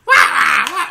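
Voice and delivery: high, loud, breathless duck squawks; bad Donald Duck voice with laughter. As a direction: quack out the words like a cartoon duck. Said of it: "really good Donald Duck impersonation".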